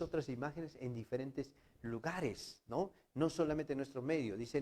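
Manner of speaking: normal talking voice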